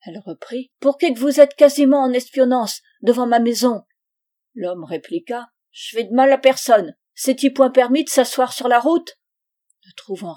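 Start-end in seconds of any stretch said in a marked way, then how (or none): 0.8-3.8 loud, high accusing question; angry, raised-voice challenge; furious. Acted. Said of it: "la Martin devint furieuse". 5.7-9.2 loud, raised-pitch self-defence; defiant vagabond, voice raised; indignant retort. Acted. Vagabond answers back in the quarrel.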